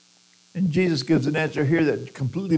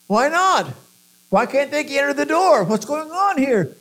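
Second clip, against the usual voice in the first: indignant protest; 10 semitones higher, 3 dB louder than usual. Retold or imitated. Excluded people protesting, voiced.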